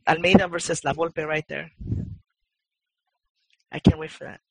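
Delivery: normal talking voice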